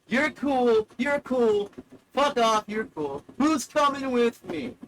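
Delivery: high, loud mock tirade; hammed-up loud send-off; acted outburst. Acts out a quitting outburst.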